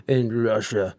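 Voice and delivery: put-on a slow, half-hearted stab at a Russian accent; mock Russian accent, drawn out and failing; attempted Russian accent. Joking about Putin fighting bears in a weak Russian accent. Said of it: "I can't do the Russian accent right now".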